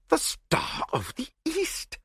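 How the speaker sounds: slow, high gasp of amazement; astonished, choked exclamation. A narrator's reading. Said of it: "gasped Lord Yardley".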